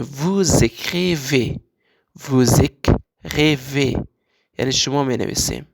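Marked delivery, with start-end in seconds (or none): 0.0-4.0 "vous écrivez" said very slowly, z linking vous and écrivez; slow, drawn-out teacher model "vou-zécrivez"; liaison demo. Shown as a demonstration.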